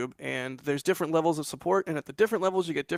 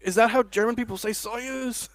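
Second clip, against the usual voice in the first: higher-pitched mock astonished question; quoted puzzled reaction; 7 semitones higher than usual. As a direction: pitch it up as an incredulous aside. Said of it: "I was like".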